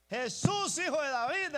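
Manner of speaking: loud, high desperate cry; a blind beggar shouting for Jesus; shouting like mad. Acted. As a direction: call out over the crowd. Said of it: "empezó a gritar como loco".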